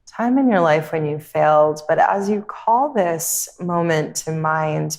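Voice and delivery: calm, unhurried meditation voice with long pauses; guided meditation. Real, not acted. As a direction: guide them gently inward, leaving space between phrases.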